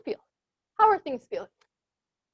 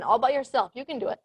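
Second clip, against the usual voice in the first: usual speaking voice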